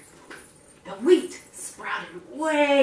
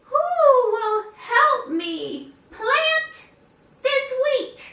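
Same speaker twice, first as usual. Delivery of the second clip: much higher, slow whiny hen voice; pleading hen; 3 dB louder, much slower than usual. Acted. Hen asks the neighbors, high slow plea. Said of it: "she asked them".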